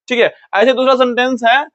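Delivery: usual speaking voice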